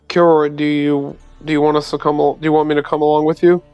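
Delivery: normal talking voice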